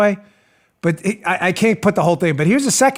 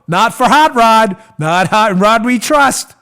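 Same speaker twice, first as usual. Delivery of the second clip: sarcastic slow; 5 dB louder, slower than usual. Real, not acted. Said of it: "mocking slogan".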